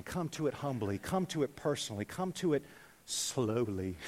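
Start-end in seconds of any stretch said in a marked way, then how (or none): 3.0-4.0 low, hushed, lingering word; slow and soft. Shown as a demonstration. Preacher urges newcomers to the Bible, slowly and softly. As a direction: let the word settle softly.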